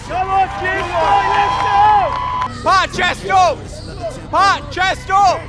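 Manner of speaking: loud, high cheering from the crowd; crowd shouting. Real, not acted. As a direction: yell encouragement across the hall. Cheering a posing competitor from crowd.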